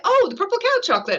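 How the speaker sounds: high, quick burst of delighted recognition; light up like you just spotted a childhood treat; a quick, high squeal of nostalgic delight; excited recognition. Retold or imitated. Sudden nostalgic recognition of chocolate.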